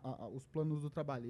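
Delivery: ordinary delivery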